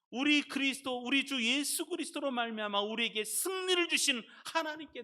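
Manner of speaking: high, loud proclamation of thanks; declaring scripture. Real, not acted. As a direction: declare it with full-voiced force. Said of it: "선포하듯이 이 말씀을". (선포하듯 힘차게)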